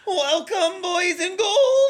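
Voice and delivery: high, slow, creepy cackle voice; Crypt Keeper voice. Pretend. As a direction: screech it like a ghoulish host.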